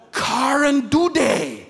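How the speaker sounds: "Karen Dude" as a drawn-out botched name; mangled name. Retold or imitated. People mispronouncing his name as "Karen Dude", slow and mangled. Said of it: "if people say it's".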